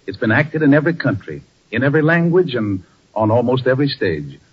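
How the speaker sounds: announcer introduction. Acted. Radio host introduces the broadcast.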